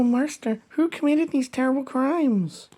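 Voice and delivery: ordinary talk, nothing marked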